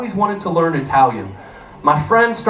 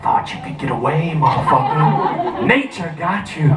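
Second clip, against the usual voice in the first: taunting inner voice; 2 semitones lower than usual. Retold or imitated. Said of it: "I hear in the back of my mind".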